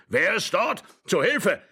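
high, urgent cries for help; old man yelling in a panic; alarmed shouting. A narrator's reading. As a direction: call everyone in, agitated and high.

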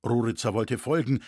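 plain reading voice

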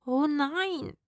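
high, plaintive wail; whining. Acted. Rufus fears ghosts, wailing high. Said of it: "jammerte Rufus".

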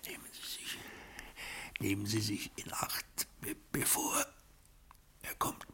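faint, breathless whisper; barely voiced last warning; dying whisper. Dying man warns butler.

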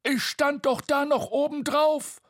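high, indignant protest; scolding. A narrator's reading. Bo blaming Tete for the fall, indignant. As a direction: complain crossly in a squeaky high voice. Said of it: "schimpfte Bo".

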